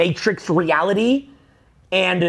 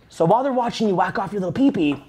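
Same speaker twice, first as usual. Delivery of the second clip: ordinary talk, nothing marked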